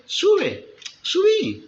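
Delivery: high ironic dare. Acted. Micaías daring king Acab, ironic and high. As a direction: tease him into going. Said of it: "Era algo iró".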